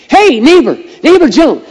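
high, loud holler to the neighbor; acted loud neighborly shout; calling a neighbor. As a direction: call out over to next door. Neighbor calling to another in story.

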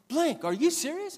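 a tough guy caught off guard; amazed mechanic. Retold or imitated. Mechanic voiced in a retold scene.

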